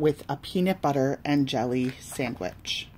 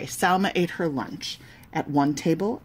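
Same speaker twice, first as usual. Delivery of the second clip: ordinary delivery, nothing marked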